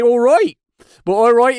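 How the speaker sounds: loud, high West Country "all right"; West Country farmer accent. Shown as a demonstration. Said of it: "do a farmer accent from the West Country".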